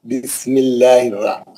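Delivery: بسم اللہ recited slowly, lam of اللہ light after zer; slow recitation, light lam; thin lam demo. Shown as a demonstration. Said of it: "زیر آیا تو انہیں بھی پتلا".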